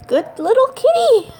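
high, giggly pet voice; cutesy kitten voice. Pretend. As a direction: squeak it out laughing, very high. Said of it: "I'm a".

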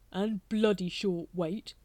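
a hint of a sour tradesman; grumbling brother. A narrator's reading. Doorkeeper complaining about the greengrocer, sourly. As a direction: mutter a complaint about the grocer.

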